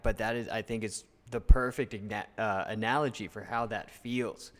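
ordinary talk, nothing marked

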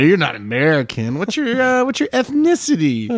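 high, swooping voice of a prying friend; Mike. Retold or imitated. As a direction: imitate a buddy probing with long, awkward fillers. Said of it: "He's like".

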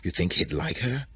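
hushed whispering. A narrator's reading. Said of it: "They are whispering".

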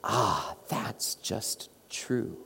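slow, hushed whisper of recognition; awed whisper. Retold or imitated. Listener hearing the gospel, slow awed whisper. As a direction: breathe it out like a quiet revelation. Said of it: "you go".

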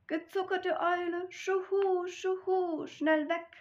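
a frightened owl fleeing; panicked owl. Acted. Owl flees the mouse, panicked high hooting. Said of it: "rief die Eule".

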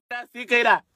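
usual speaking voice

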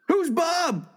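high-pitched indignant question; outraged surprise. Acted. Husband confronting wife over her tattoo, shocked. Punchline repeated in cleaned-up version.

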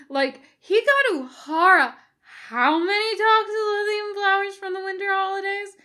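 high, insistent whine; whining. A narrator's reading. Jim arguing to Bones about Spock's feelings, whining. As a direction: plead your case in a high whine. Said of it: "Jim whines".

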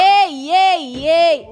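loud repeated hype cries; hype call-out. Pretend. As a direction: pump up the crowd. Mock hype calling out.

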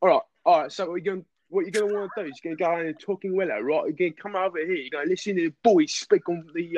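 chatty, matey Cockney patter; Cockney accent. Shown as a demonstration. Friend shows off a Cockney accent on request, Cockney. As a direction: talk like a Cockney giving directions to the pub. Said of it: "can you do a good Cockney?".

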